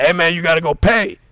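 loud, high-pitched mock demand; retold barber's insistent shout; imitated mad barber. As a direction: call out like an irritated barber. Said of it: "He was just mad".

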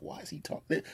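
whispered, disbelieving aside; whispered gossip. Retold or imitated. Onlookers whispering about him and the lady: whispered disbelief. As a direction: hiss it to the person beside you. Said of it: "they're looking at me like".

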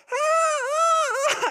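high, loud imitation of a toddler's wail; toddler scream. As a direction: mimic a little kid screaming and crying. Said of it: "he starts screaming".

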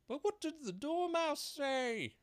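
high, slow juror; eager curious juror. A narrator's reading. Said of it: "one of the jury asked".

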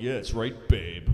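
low, smug mock husband. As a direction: drop it low and self-satisfied. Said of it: "he had this smug look like".

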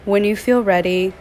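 soothing yoga nidra voice, phrase by phrase; guided meditation. Acted. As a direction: gently guide them into stillness, stretching key words. Leads a meditation.

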